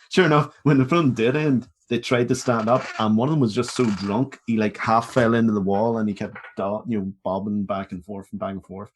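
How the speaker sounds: ordinary delivery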